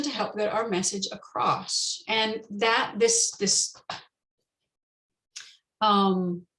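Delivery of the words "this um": ordinary talk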